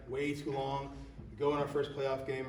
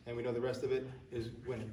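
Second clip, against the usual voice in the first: ordinary talk, nothing marked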